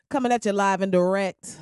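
normal talking voice

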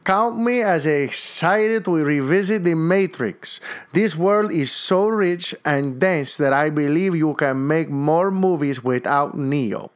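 exaggerated, drawn-out Puerto Rican accent; mangled Puerto Rican accent. Podcaster reading a Puerto Rican listener's message in a mangled Puerto Rican accent. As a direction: put on a slow, heavy Puerto Rican accent. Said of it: "I try to get their accent".

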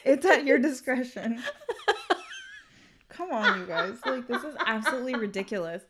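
laughing, exasperated disbelief. Real, not acted. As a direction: laugh at how absurd it is. Laughing at absurdity.

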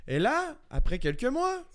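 storytelling in a put-on French accent; accent français de France. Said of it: "j'ai chié l'autre avec l'accent français". (faux accent de France, plus aigu)

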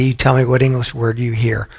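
ordinary delivery

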